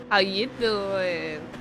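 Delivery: slow drawn-out "How you doing" in a put-on voice; cheesy pickup line. Shown as a demonstration. Caster joking with viewer Howie, slow flirty "How you doing". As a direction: draw the words out like a smooth flirt. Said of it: "I think I'm so funny".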